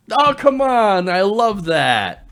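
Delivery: loud, high burst of delight, stretched at the end; delighted exclamation. Real, not acted. Host reacting to the best tweet, loudly delighted. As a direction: gush over a favorite joke, drawing out the last words.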